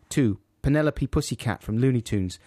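ordinary delivery

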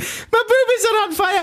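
very high, hammed-up Scottish falsetto; Missus Doubtfire. Host outdoing co-host's film impression, silly falsetto. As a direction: squeal it as a daft Scottish matron. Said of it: "Robin Williams in Missus Doubtfire".

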